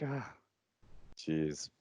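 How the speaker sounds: very soft, defeated aside; retold embarrassment. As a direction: say it under your breath, embarrassed. Retelling his embarrassed walk out.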